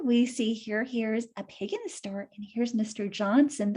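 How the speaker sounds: ordinary delivery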